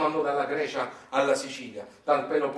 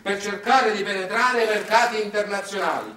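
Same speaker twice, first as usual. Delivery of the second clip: ordinary delivery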